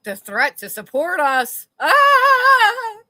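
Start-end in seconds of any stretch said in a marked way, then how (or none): 1.8-3.0 sudden high shriek; scream. Real, not acted. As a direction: let out a high loud scream. Scream after reading.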